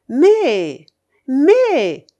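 high, loud order to bet; commanding. A narrator's reading. Old gambler orders her companion to bet, commanding. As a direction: bark out an impatient command. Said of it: "me commandait-elle".

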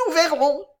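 high, loud, tart retort; shrill defiance. A narrator's reading. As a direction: answer back shrilly. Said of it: "elle dit d'une voix aigrelette". (réplique aigrelette et forte)

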